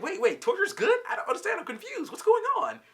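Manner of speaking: very high, flustered confusion; mock panicked squeal of bewilderment, half laughing; imitated freak-out. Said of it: "she freaks out even more".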